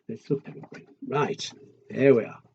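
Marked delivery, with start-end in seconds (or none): 0.1-0.9 whispered aside. Acted.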